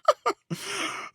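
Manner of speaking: short high breathy laugh; a high nervous chuckle. Acted. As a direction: laugh it off, uneasy. Laugh in dark place.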